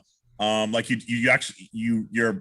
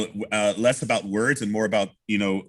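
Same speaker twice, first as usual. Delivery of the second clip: usual speaking voice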